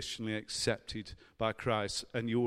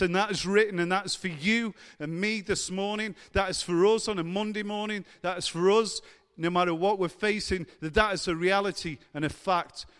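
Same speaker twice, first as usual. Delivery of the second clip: loud, high, insistent pulpit exhortation; fervent preaching; 6 semitones higher, 5 dB louder than usual. Real, not acted. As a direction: drive it home to the congregation. Preaches the verse home.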